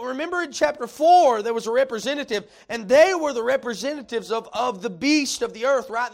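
high, loud pulpit delivery, building; full-force preaching. Real, not acted. Preacher telling the congregation the speaking has changed, full-force preaching. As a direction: drive each phrase out like a fired-up revival preacher.

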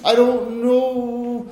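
slow, hesitant mock refusal, lightly voiced; coy Irish lady. Second Irish lady tempted by cake, slow coy refusal. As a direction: drag out the polite no. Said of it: "the Irish lady was like".

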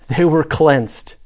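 normal talking voice

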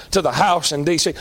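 ordinary talk, nothing marked

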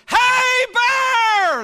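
piercing loud high shout; yelling as loud as possible. Retold or imitated. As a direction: shout with everything you have, high and loud. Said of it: "as loud as I possibly can".

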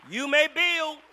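high, loud, slow shouted preaching; preaching at full force. Acted. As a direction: build the sermon to a roaring climax.

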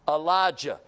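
solemn scripture read. Acted. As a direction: read the King James passage with weight. Reading scripture aloud.

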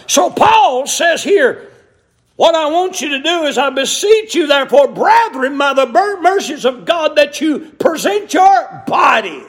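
high, ringing pulpit scripture reading; fervent preacher's exhortation; full-force preaching. Real, not acted. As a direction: proclaim it to the whole church, pitched high.

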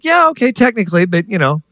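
high-pitched mock concession; reluctant, high "yeah, okay"; grudging attitude. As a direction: concede it while wriggling out. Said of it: "I had an attitude like Jonah".

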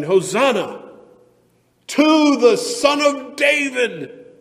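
high, drawn-out jubilant shout; crowd shouting. Acted. Palm Sunday crowds hailing Jesus, shouting. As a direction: shout the acclamation out at full voice. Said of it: "were shouting".

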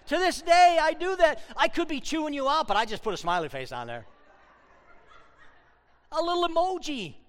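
loud, high excited preaching. Real, not acted. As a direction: burst out with high, loud energy. Excited preaching about smiley face.

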